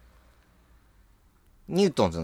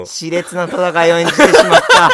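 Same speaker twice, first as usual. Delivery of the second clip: high, loud dramatic announcer voice; mock announcer; 6 semitones higher, 8 dB louder than usual. Host announcing the mimicry battle, louder and hammed-up. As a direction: call it like a big match.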